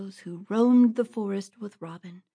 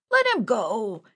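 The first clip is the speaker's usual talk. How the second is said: pleading bandit; 2 semitones higher, 4 dB louder than usual. Acted. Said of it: "argued a fourth man".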